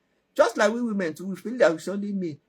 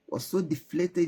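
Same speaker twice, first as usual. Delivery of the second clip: usual speaking voice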